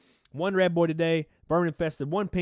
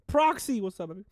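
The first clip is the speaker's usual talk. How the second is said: excited greeting; 6 semitones higher than usual. Real, not acted. Calling out a viewer's name.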